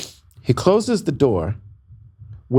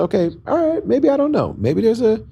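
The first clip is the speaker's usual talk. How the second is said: higher and higher, rattled and unsure; panicked disbelief; 12 semitones higher than usual. Retold or imitated. To the doctor in the back room, climbing panicked pitch.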